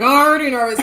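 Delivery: high, loud reenacted panic; retold frantic retort, teeth held shut; nervous through the teeth. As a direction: burst out in comic panic with a clenched jaw. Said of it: "a whole conversation through the teeth".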